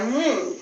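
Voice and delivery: मुंह said softly with a nasal उ; nasalised vowel. Shown as a demonstration. Teacher repeats the correct word for children, nasalised vowel. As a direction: let मुंह hum softly through the nose. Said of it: "मुंह बोला जाता है".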